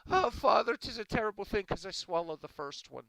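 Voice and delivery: high, loud Irish woman wailing to her priest; hammed-up panicked confession in a brogue; distraught Irish woman. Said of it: "She said".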